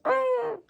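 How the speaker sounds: sudden high yelp; mock ouch, very high; yelp of pain. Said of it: "and he's like".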